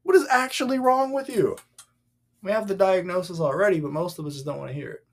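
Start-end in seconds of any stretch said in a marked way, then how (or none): 0.0-1.6 exasperated. Acted. Acts God's exasperation.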